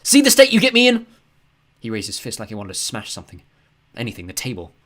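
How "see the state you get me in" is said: furious yell, much higher and louder. A narrator's reading. Father yelling at his son in the kitchen. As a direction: snap it at him. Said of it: "he yelled".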